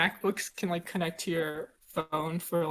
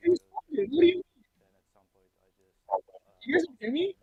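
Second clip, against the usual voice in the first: muffled, boxed-in mic sound; inside a fishbowl; 7 semitones higher than usual. Real, not acted. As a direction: voice trapped in a fishbowl. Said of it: "It sounds like you're talking in a fishbowl".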